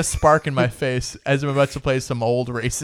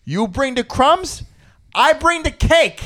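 higher, slow mock macho Jersey guy; imitating Joe Gorga; 12 semitones higher, slower than usual. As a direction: drag it out smugly like a brother boasting. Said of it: "Joe Gorga goes".

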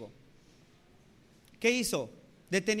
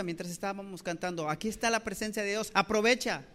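normal talking voice

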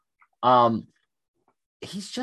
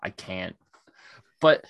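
ordinary talk

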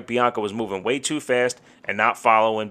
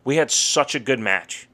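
usual speaking voice

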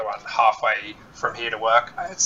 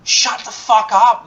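slow, loud, higher snap; angry; 9 semitones higher, 5 dB louder, slower than usual. Retold or imitated. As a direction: hiss it out, fed up. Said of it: "I'm just like".

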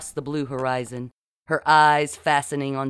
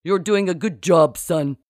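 fake captain; 3 semitones higher, 7 dB louder than usual. A narrator's reading. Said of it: "Marcy added unnecessarily".

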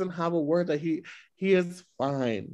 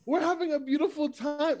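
incredulous; 9 semitones higher than usual. Retold or imitated. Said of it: "It's like".

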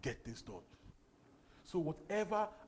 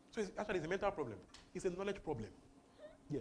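ordinary delivery

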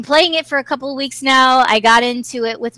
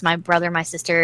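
ordinary talk, nothing marked

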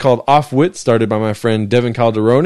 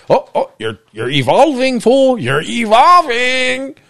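mock Ancestry voice; 11 semitones higher, slower than usual. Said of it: "it's like".

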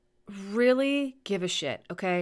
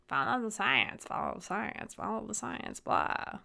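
mocking parrot; 3 semitones higher, 5 dB quieter than usual. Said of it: "somebody tells me to follow the science".

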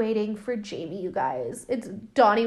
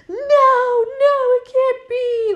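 much higher and louder, a horrified cry of no; horrified denial; 8 dB louder, slower than usual. Retold or imitated. Henry unzips the body bag and recoils, crying out in horror. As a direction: gasp it out as if you can't believe it. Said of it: "he's, like, horrified".

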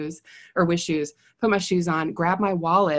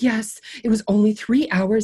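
a hint of a fussy neighbor; prim lady; 4 semitones higher, 4 dB louder than usual. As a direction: drag it out like a petty complaint. Mimicking the neighbor.

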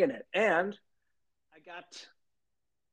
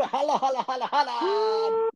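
excited holla; 10 semitones higher, 3 dB louder than usual. Real, not acted. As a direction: stretch it out and cry it. Repeated greeting, second more drawn.

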